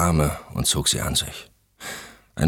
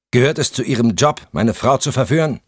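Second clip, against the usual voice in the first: accusing husband; 9 semitones higher, 6 dB louder than usual. Acted.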